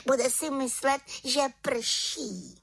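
high-pitched giggling little witch; pochechtávání. A narrator's reading. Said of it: "Čarodějnice se pochechtávala". (pochechtávat se vysokým hláskem)

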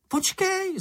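very high creature call. A narrator's reading. Čenich calls after Mumínek to wait, high cry. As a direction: squeal it after someone running ahead. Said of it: "zvolal Čenich".

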